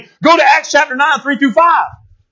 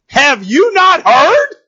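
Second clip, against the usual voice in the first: high, very drawn-out challenge; challenging; 7 semitones higher, much slower than usual. Retold or imitated. God confronts the boastful enemy, slow challenge. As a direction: stretch each word of the question, pitched up.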